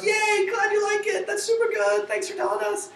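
bubbly app thrilled by a thumbs up; gushing excitement. Retold or imitated. App thanking the user for a thumbs up, high giddy glee. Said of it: "it has that tone".

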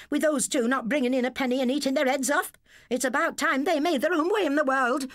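high, fast shrieking wife; angry rant. A narrator's reading. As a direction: tear into the husband. Dora rants at husband.

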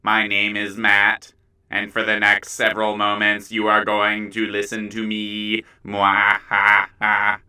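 low, slow evil overlord; mysterious villain voice. Acted. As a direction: menace them like a villain. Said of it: "This is my normal voice".

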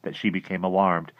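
ordinary delivery

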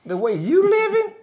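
sudden high mock disdain; scornful dismissal. Jealous churchgoer scoffing at a woman, mocking disdain. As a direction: sneer it down at her. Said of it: "and I say".

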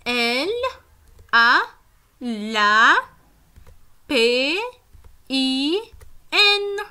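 each letter of D, I, M, A said slowly and apart; spelling aloud. Shown as a demonstration. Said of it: "Comment j'écris lapin?". (épeler lettre par lettre)

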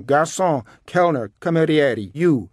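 high, loud string of summonses; imperious calling out; shouting. A narrator's reading. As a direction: hail the waiter boisterously. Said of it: "began shouting again".

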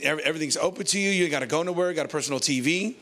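normal talking voice

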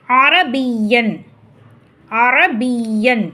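அரபியன் slowly, leaning on a doubled y; pressed doubled y. Shown as a demonstration. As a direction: hold "biyy" long in அரபியன். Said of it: "நல்லா அழுத்தி உச்சரிக்கும்".

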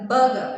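ബർഗർ as "buh-guh", stopping on the uh; no r sound. Shown as a demonstration. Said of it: "എന്ന് പറയുന്ന സൗണ്ടിൽ നിർത്തുക".

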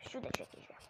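uyuyan olduğu için fısılda; fısıltı. Real, not acted. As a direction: sesi kısarak, nefesle söyle. Whispering so brother sleeps. (abisi uyuduğu için fısıltıyla konuşma)

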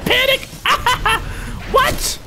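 panicked laugh. Real, not acted. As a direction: laugh at your own doom as it hits. Overwhelmed by a heavy machine gun.

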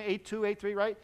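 ordinary talk, nothing marked

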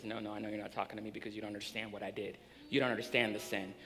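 soft, fast, ashamed protest; ashamed Peter. Retold or imitated. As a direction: rush it out in shame, barely audible. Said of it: "he's gonna be the one that says".

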